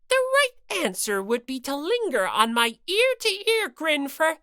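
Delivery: lightly voiced scolding bear mascot; Monokuma voice. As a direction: nag her like a petty bear.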